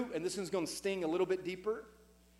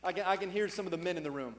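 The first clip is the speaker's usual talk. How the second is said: ordinary talk, nothing marked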